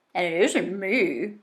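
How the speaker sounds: talking through a mouthful; mouth full. Acted. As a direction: say it round a mouthful of food. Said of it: "his mouth full of space worms".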